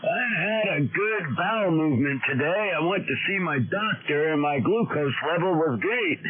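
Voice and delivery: lightly hammed-up old ham reciting his checkup; mocking old-timer net chatter. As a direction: parody the old guys reporting their ailments on air. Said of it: "the nets were more like".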